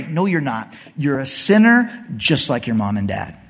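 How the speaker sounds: normal talking voice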